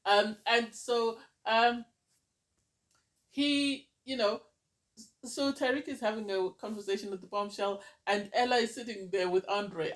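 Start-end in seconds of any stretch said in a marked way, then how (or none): none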